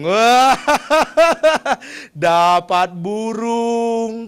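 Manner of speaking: loud laughing cry, stretched slowly; madman laughing. Acted. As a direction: giggle it out like a delighted madman. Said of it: "Dia bilang".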